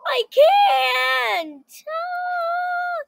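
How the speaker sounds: high crying shout. Real, not acted. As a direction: wail out high, overwhelmed. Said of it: "Are you crying?".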